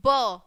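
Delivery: ब said as a short, punchy b sound; letter sound b. Shown as a demonstration. Teacher showing the class how B sounds, crisp and loud. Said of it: "बी की कैसी sound निकलती है".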